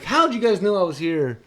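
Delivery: re-tell the cheerful entrance line; retold happy greeting. Uncle retelling his entrance to the family, mildly excited.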